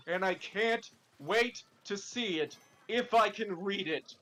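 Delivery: normal talking voice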